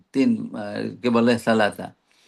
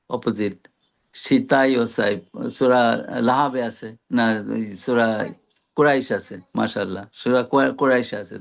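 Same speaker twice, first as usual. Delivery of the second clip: ordinary talk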